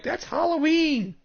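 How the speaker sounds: high, squeaky mock exclamation; mocking people's exclamation. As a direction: parrot the crowd in a silly high voice. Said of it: "people are like".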